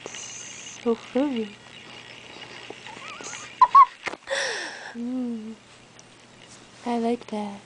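seductive. Acted. Fake seductive purring.

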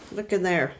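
normal talking voice

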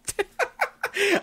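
high-pitched staccato laugh; high giggle. Real, not acted. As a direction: giggle at your own joke, up high. Laughs at own fake sign-off.

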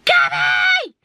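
very high, loud frantic yell; reenacted high-pitched screaming; retold scream. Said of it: "I started screaming".

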